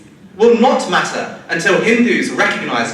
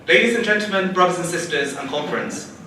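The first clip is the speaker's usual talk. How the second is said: ordinary delivery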